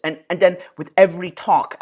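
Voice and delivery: normal talking voice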